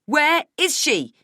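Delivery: loud, high, stretched-out demand; frantic mother's yell. Acted. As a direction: shout each word in desperation. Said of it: "I yell".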